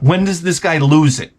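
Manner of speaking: normal talking voice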